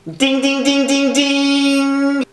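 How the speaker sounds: high, ringing mock chime; imitated bell. Comic unveiling his secret weapon, high imitated bell. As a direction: ring out dings on one held high note.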